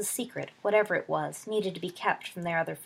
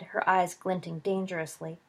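ordinary delivery, nothing marked

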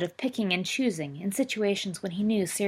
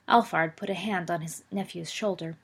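usual speaking voice

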